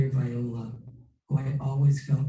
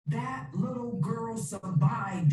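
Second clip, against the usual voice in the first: slow, higher and firm; emphatic statement; 8 semitones higher, 4 dB quieter, slower than usual. Acted. Therapist insisting to his client, slow emphatic statement. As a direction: lay each word down with slow insistence. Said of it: "he stated emphatically".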